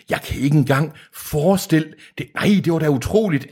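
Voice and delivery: high, awestruck villain voice; theatrical, high-pitched wonder; acted amazement. A narrator's reading. Deacon marvels at the pictures.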